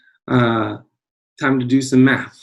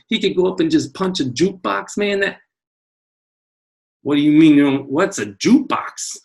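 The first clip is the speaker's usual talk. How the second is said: ordinary delivery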